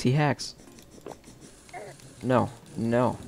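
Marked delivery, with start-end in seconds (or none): none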